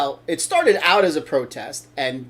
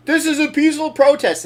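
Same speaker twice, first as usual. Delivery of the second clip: raised, brisk crowd call; guy in the background; 9 semitones higher, 5 dB louder, faster than usual. Retold or imitated. Co-host imitating a guy at the protest, raised call. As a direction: call it out quickly, higher and louder. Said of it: "this guy in the background go".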